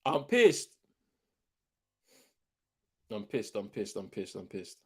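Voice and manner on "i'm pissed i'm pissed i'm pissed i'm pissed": much softer, repeated grumble under the breath; quiet sulky mumble; muttered sulk. Real, not acted. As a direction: mutter it to yourself, deflated. Sulking after red card.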